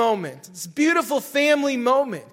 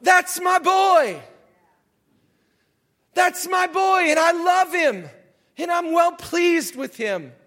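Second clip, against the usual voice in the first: high, raised proud-father voice; shouting proudly; 5 semitones higher than usual. Acted. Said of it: "This voice just shouts out".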